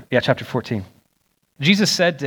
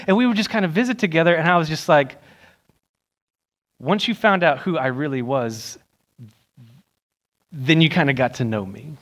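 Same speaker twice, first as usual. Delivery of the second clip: normal talking voice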